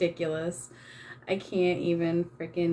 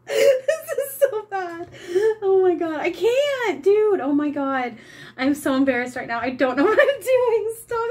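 much higher and louder, laughing almost to tears; laughing fit; 10 semitones higher, 5 dB louder than usual. Real, not acted. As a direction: gasp the words through a laughing fit. Helpless laughter at her painting.

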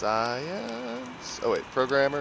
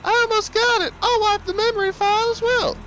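high woman voice; 6 dB louder than usual. Pretend. Voicing Pauline.

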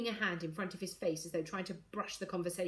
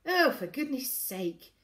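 slow, louder exasperated exclamation; impatient; 5 semitones higher, 5 dB louder, slower than usual. A narrator's reading. Father fed up with daughter's questions, impatient. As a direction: drag it out with exasperation. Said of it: "He made a noise of impatience".

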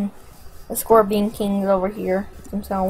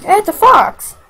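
mock surprise; 10 semitones higher, 7 dB louder than usual. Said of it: "I got that face like".

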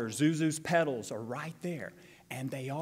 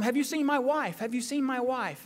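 excited question; 7 semitones higher, 4 dB louder than usual. Retold or imitated. George frantic, retold movie.

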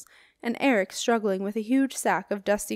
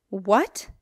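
gasped; 4 dB louder than usual. A narrator's reading. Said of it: "gasped Silas".

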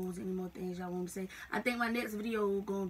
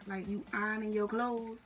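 ordinary talk, nothing marked

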